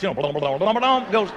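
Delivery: usual speaking voice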